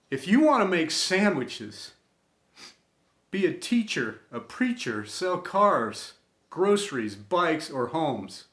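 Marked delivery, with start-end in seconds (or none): none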